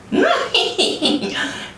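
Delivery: high, short yappy laugh; a dog's mocking chuckle; little dog laughing. A narrator's reading. Little dog laughing at the fallen egg, high laugh. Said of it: "A little dog laughed".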